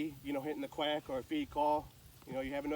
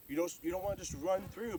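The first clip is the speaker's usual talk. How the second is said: ordinary delivery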